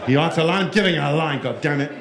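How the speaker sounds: lightly mocked German accent; put-on German grumble. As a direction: play an exasperated German finance type. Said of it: "they always have a German accent".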